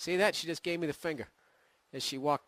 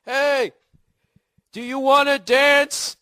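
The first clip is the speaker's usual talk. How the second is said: high, loud, drawn-out shout; yelled pickup line; 9 semitones higher, 9 dB louder, slower than usual. Retold or imitated. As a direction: holler it slowly across a noisy dance club. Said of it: "I would yell".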